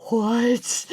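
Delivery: stunned "what" breaking into a high laugh; incredulous laugh. Real, not acted. Vlogger reacts to the woman's absurd claim with a disbelieving laugh. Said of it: "That's the craziest thing I've ever heard".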